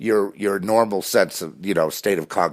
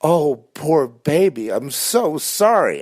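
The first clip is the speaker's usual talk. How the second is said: slow, high, louder mock sympathy; sarcastic pity; 10 semitones higher, 4 dB louder, slower than usual. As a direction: drip fake pity like to a baby. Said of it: "And then the sarcasm".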